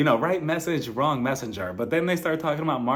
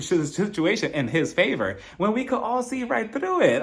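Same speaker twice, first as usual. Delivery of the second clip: usual speaking voice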